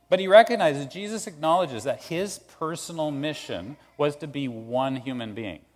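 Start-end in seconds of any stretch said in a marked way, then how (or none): none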